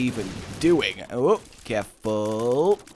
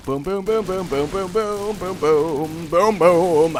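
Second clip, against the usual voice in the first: playful mock explosions; 5 semitones higher, 5 dB louder, slower than usual. Imitating firework rhythm.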